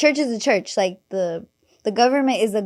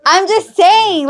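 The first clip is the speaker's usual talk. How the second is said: laughing through the words, higher and louder; 8 semitones higher, 8 dB louder, slower than usual. Real, not acted. Laughing while defending her church joke to friends, high and loud. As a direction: say it still laughing.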